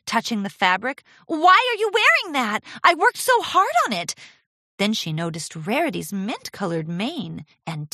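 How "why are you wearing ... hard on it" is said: high, loud, upset protest; crying out. A narrator's reading. Apprentice protesting mentor wearing her dress, crying out. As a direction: whine with hurt indignation. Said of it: "Charity cried".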